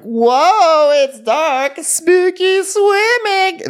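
spooky-night swimmers squealing; high, loud, drawn-out spooky squeal; squealing women. Retold or imitated. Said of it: "they're like".

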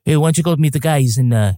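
light mock Boston accent. Mocking what the film lacked, light Boston accent. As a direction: toss it off like a Boston local. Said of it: "a little more like".

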